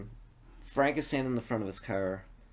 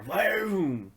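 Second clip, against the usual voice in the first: high swooping "boom"; imitated car whoosh; 8 semitones higher than usual.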